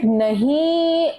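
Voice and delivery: high, long drawn-out "no"; mock disbelieving denial. Mimicking the relative defending her brother-in-law, drawn-out denial. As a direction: imitate a refusal to believe, stretched out high. Colleague's in-law refuses to believe it.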